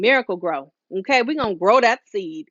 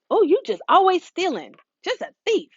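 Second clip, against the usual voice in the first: mock parent scolding, pitched much higher; exasperated parent calling their kid a thief; 10 semitones higher than usual.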